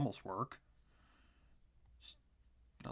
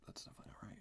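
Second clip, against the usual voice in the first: whispered; 12 dB quieter, much faster than usual. Real, not acted. Muttering to self while drawing.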